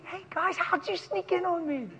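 high, soft surprised greeting; a quiet happy hello off to the side; delighted aside. Real, not acted.

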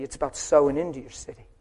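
ordinary delivery

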